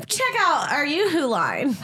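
slow, high hammed-up ad read; commercial voice. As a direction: pitch it like a TV spokesperson. Mock sponsor pitch.

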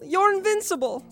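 desperate denial. Acted. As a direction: push the plea to despair. Repeated denial, higher.

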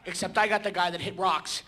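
ordinary delivery

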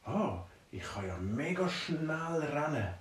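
soft, slow, amazed; drawn-out discovery; slow amazed wonder. Acted. Dwarf discovering his dream speed, slow and softly amazed.

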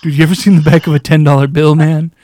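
loud, hammed-up stoner marvel; stoner guy. Joking about getting centered, hammed-up stoner voice. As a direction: ham up a baked stoner wowed by a bill. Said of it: "I'm gonna be that guy".

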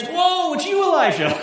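high, loud startled exclamation, laughing; mock surprised. God bursting into the cave, mock surprised. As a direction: play God as comically caught off guard. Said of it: "surprised, exclaims".

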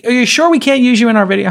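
high, wheedling mock request; hopeful ask. Host imitating the founder asking again a year later, high and wheedling. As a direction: ask sweetly as if nothing happened. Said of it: "it's like".

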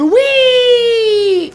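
high squeal of glee; gleeful whee. Acted. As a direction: squeal it like a kid on a slide. Sliding down a water slide.

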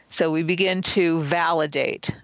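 ordinary delivery

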